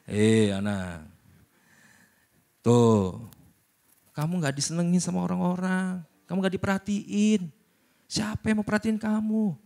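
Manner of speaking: tempting devil. Acted. Devil whispering provocation.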